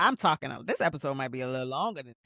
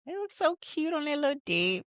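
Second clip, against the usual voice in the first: gushing; 10 semitones higher than usual. Acted. Gushing over the couple.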